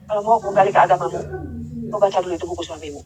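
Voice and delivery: low, hushed instruction; soft mysterious voice. Retold or imitated. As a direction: speak softly, like an unseen voice. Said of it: "Pelan ngomongnya, pelan".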